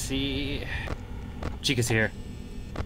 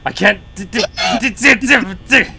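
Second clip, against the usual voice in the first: panicked stammer; 9 semitones higher, 11 dB louder than usual. Real, not acted. Panicked stammer at jump scare.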